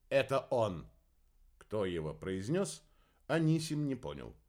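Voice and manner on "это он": usual speaking voice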